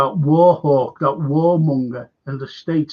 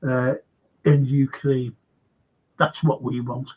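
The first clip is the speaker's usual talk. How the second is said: usual speaking voice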